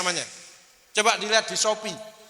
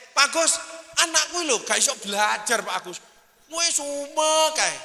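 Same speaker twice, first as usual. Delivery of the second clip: higher-pitched retold parental grumble, stretching the last words; exasperated complaint; 7 semitones higher than usual. Mother complains to Pak Agus about her child's studying, exasperated.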